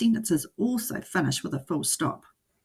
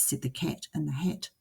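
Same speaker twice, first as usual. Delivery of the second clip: plain reading voice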